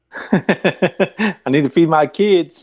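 laugh, then put on an Appalachian accent; hammed-up mountain twang, Appalachian style; Appalachia voice. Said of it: "that was a perfect Appalachia voice".

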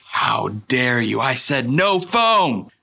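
hammed-up insulted coffee customer; mock outrage. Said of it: "You know, huge insult".

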